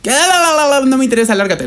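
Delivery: high, singsong brush-off, mocking the guard; la-la-la, not interested, get lost; dismissive mockery. Mocking the Walmart guard who wouldn't listen, singsong brush-off. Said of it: "Fue así como de".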